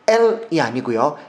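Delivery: normal talking voice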